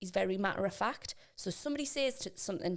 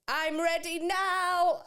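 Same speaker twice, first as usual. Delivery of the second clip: mock-posh child, high and loud; slow, drawn-out posh announcement from a child; 10 semitones higher, 7 dB louder, much slower than usual. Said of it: "really posh voice".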